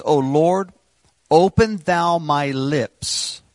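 high, loud and very slow, like a psalm; lifted preacher's reading of the psalm; solemn scripture reading. Acted. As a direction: intone the verse as a heartfelt plea.

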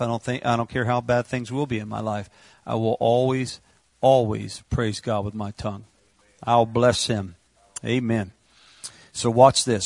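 ordinary talk, nothing marked

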